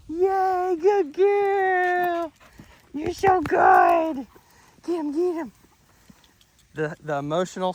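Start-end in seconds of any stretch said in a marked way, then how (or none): 0.1-5.5 high, slow, gushing praise; high dog praise. Real, not acted. Trainer gushes over Ellie playing, high and drawn out.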